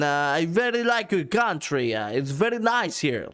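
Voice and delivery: drunkenly put-on Italian accent; bad Italian accent. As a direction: botch an Italian accent so it drifts toward Russian. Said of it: "That sounds Russian".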